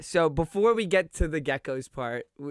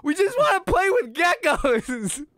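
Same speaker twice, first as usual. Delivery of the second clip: exasperated; 13 semitones higher, 5 dB louder than usual. Retold or imitated. Exasperated teen protest retold.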